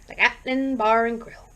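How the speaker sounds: usual speaking voice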